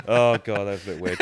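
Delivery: ordinary delivery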